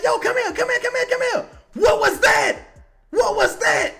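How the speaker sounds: high, loud, urgent angry coach; Borrego. Acted. Coach calling players over to scold them, angry shout. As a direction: summon them fast, then yell the question. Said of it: "Borrego should be like".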